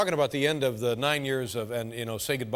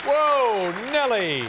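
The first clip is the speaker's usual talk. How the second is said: excited exclaim; 9 semitones higher, 6 dB louder, much slower than usual. Real, not acted. Host reacts to shoes.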